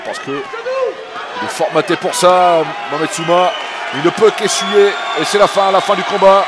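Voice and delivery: excited call. Real, not acted. Fight ends with a stoppage.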